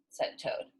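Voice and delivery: plain reading voice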